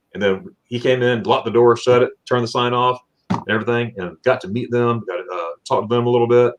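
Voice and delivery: ordinary talk, nothing marked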